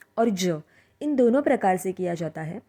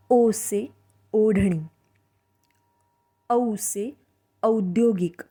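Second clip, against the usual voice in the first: ordinary talk, nothing marked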